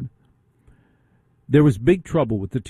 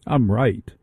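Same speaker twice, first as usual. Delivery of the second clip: normal talking voice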